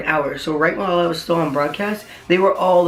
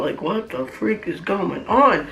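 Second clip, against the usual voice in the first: slow, drawn-out retold disbelief; freaking out; 3 semitones higher, slower than usual. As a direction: recount being bewildered, stretching the words. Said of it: "freaking out".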